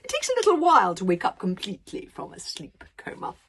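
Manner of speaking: a giant savouring every word of his philosophy; pompous dragon philosopher. Acted. Dragon lecturing Hiccup on life, loud and grandiose.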